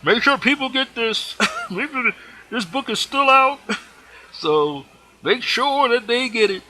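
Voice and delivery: high, excited preacher impression with laughs; high-pitched mimicked preacher urging, chuckling; channeled Reverend Ike. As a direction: pitch it way up, laughing between phrases. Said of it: "so he says".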